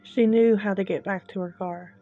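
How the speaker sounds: ordinary delivery, nothing marked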